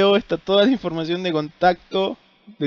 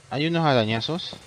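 ordinary talk

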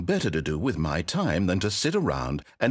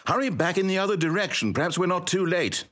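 panicked; 8 semitones higher than usual. Acted. Panicked after ruin.

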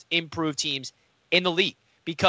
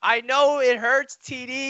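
high, slow, mock-sympathetic tease; teasing; 7 semitones higher, slower than usual. Real, not acted. Teasing his cohost about the hurt, slow and high. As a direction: pat him on the head with your voice.

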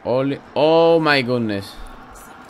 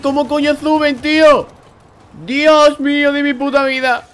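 high, loud disbelieving scream; panicked yell; 13 semitones higher, 9 dB louder than usual. Real, not acted. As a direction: scream it at the screen in total panic. Enemies climbing, panic screaming.

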